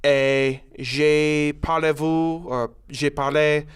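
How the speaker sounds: hammy schoolboy French with hesitations; mock French. Asked for an accent, student introduces himself in mock French. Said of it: "do any sort of accent, I'd like to hear it".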